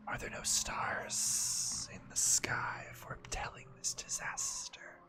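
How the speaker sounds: slow whispering serpent voice. Acted. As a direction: breathe it out like an ancient serpent. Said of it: "You like my serpent voice?".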